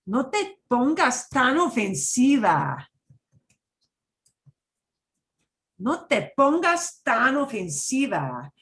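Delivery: sassy. Shown as a demonstration. Teacher demoing with extra attitude, sassy. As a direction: put her in her place. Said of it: "with quite that much attitude".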